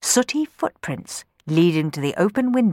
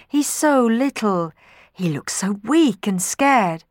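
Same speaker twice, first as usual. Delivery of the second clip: pitying; 6 semitones higher than usual. Acted. Children pitying Sammy.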